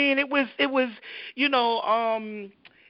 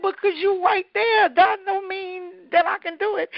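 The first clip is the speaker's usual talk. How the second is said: put-on high, pleading child voice; panicky little girl; 8 semitones higher than usual. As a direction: refuse in a scared kid voice. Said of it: "I said".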